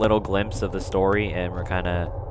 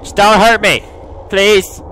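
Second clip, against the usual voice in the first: loud, higher-pitched plea; nervous pleading; 7 semitones higher, 7 dB louder than usual. Real, not acted. Player begging the ghost girl not to hurt them, anxious plea. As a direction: beg anxiously for mercy.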